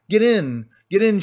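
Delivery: put-on loud drunken call to get in; shouting. A narrator's reading. Drunk peasant shouting to crowd to get in. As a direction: bellow it to the crowd. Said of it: "shouted one of them".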